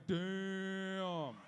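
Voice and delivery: one long, held, level exclamation; very drawn-out mock amazement; mock-awed crowd. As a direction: stretch it out like a stunned party. Whole party reacts in awe.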